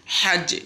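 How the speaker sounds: hajje with a strong throaty h, said high and loud; Arabic recitation demo. Shown as a demonstration. Teacher models the letter for students to repeat, recited clearly. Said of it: "আবার পড়ছি".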